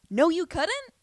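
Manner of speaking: high, loud rising retort; incredulous denial. Retold or imitated. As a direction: throw it back loud and high, rising at the end. Repeats the reply, now indignant.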